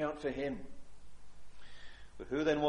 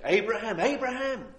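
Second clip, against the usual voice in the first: louder and higher, calling his name twice; two ringing calls, the second pitched up; urgent calling out; 5 semitones higher, 6 dB louder than usual. Acted. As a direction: call Abraham as from heaven. Voicing God calling Abraham.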